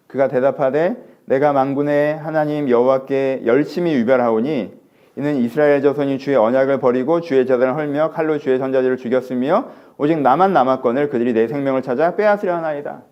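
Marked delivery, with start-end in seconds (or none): 1.2-13.0 scripture reading. Acted. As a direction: intone the verse. Reads scripture aloud.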